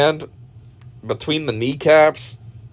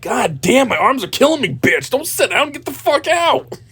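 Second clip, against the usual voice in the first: higher, exasperated mock complaint; mock cameraman; 10 semitones higher than usual. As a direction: whine like a tired cameraman. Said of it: "the cameraman's like".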